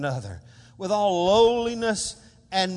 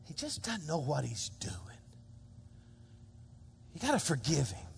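voiceless whisper of forgiveness; whispered line from the cross; hushed whisper; 5 semitones lower, 14 dB quieter than usual. Acted. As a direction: breathe it out almost silently. Jesus quoted on the cross.

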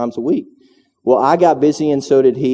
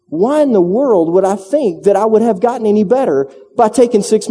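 higher, louder rising rant at himself; frustrated self-talk; 10 semitones higher, 4 dB louder than usual. Retold or imitated. Preacher to congregation, retelling his tennis rant, raised and heated. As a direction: preach it to yourself, pitch climbing. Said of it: "and I go".

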